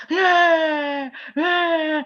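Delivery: high, loud imitated whimpering cry of a baby. Shown as a demonstration. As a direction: mimic the baby's sobbing "heh, heh". (tiruan tangis bayi yang keras dan tinggi)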